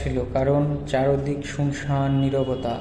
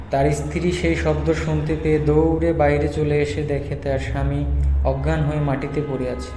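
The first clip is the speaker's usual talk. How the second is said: usual speaking voice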